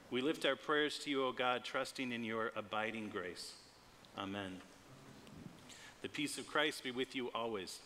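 ordinary talk, nothing marked

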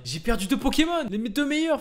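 high anguished wail; raging gamer. Real, not acted. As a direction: lament the lost team. Lament over losses.

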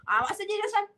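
ordinary talk

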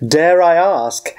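ordinary talk, nothing marked

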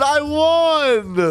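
high, drawn-out victory cry; acted gleeful boast; triumphant racer. Race winner's boast read out.

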